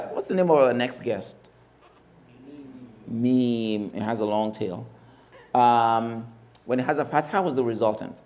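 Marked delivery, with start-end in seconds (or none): none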